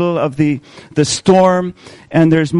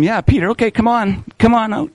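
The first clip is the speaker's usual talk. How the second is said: higher, light and encouraging; inviting Jesus; 5 semitones higher than usual. Acted. Jesus invites Peter onto the water, higher and light. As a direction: beckon him out onto the water. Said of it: "Jesus says to him".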